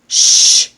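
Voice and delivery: a long unvoiced shh; shushing. Shown as a demonstration. Vlogger tells his racing mind to hush. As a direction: hush the thoughts firmly. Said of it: "I often tell myself".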